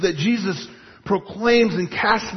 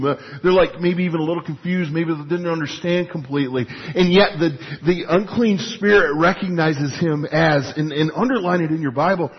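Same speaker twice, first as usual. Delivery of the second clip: ordinary talk, nothing marked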